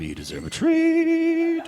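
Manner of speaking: high, drawn-out treat voice; cutesy self-praise. Retold or imitated. Comic rewarding himself after the show, cutesy praise. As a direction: coo it high and slow.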